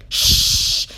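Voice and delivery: loud, forceful shushing; sharp, long shh to silence someone; hard, urgent shush; urgent hush. A narrator's reading. Charlie urgently hushes him.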